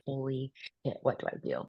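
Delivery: hushed, frightened whisper; panicked whisper. Retold or imitated. To herself hiding in the basement, a frightened whisper. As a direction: whisper it in alarm, barely voiced.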